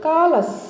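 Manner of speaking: కాలస్ high on the first syllable, falling; repeat-after-me pronunciation. Shown as a demonstration. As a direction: say కాలస్ as KAA-les for learners to copy. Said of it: "మళ్ళీ పలకండి నాతో పాటు". (కాలస్ నాతో పాటు పలకండి అన్నట్టు)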